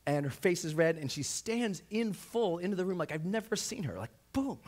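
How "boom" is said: ordinary talk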